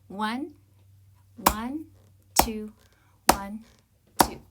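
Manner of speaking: loud count-in; counting aloud. Shown as a demonstration. Teacher counting in before clapping. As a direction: count the beats in for the class. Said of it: "I'll clap all the way down".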